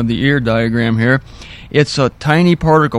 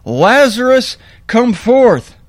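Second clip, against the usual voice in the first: commanding call; 8 semitones higher, slower than usual. Retold or imitated. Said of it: "tomb and said".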